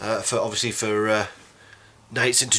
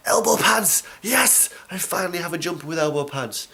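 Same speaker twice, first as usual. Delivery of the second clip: excited; 6 semitones higher than usual. Real, not acted. Thrilled about new jumper.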